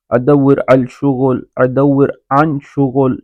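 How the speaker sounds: ادور الشغل said slowly and carefully; slow Arabic phrase. Shown as a demonstration. Teacher to learners, modelling the Arabic phrase slowly. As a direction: drill ادور عن شغل at half speed. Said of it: "یہ بھی کہہ سکتے ہیں".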